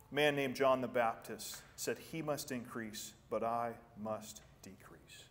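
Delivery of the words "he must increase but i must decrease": humble whisper. Retold or imitated. Quoting John the Baptist softly.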